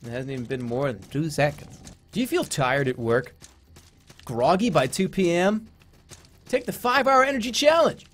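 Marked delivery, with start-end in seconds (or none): 2.1-8.1 hammed-up commercial pitch; parody ad read. Streamer pitching an energy shot mid-game, parody ad read. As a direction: sell it like a TV spot, punching the product.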